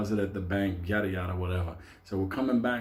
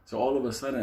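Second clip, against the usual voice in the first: normal talking voice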